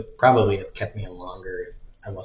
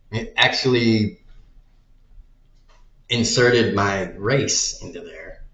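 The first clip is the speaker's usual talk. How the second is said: ordinary talk, nothing marked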